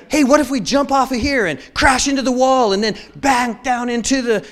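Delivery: high, loud mock-child enthusiasm; hammed-up eager grandkid; excited kid. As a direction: pitch a wild game like a hyped-up kid. Voices grandkids proposing destructive games.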